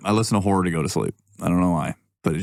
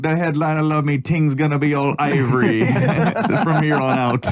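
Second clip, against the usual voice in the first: put-on higher, laughing Jamaican accent bragging; mock Jamaican accent; 7 semitones higher than usual. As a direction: imitate a buzzed friend's Jamaican accent. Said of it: "talking in a Jamaican accent".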